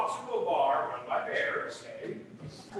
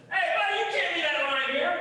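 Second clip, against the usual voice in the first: higher, fast bartender's protest; calling after someone; 7 semitones higher, 4 dB louder, much faster than usual. Acted. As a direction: call out quickly to stop a customer leaving. Bartender calls after departing bear.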